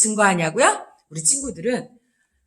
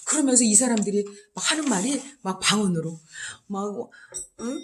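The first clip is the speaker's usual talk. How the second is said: normal talking voice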